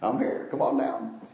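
soft West Virginia accent impression. Pastor inviting a troubled member over, West Virginia accent. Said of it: "in his West Virginia accent".

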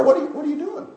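high, startled cry; startled man. Retold or imitated. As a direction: squeak it out in surprise. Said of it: "the man said to the sparrow".

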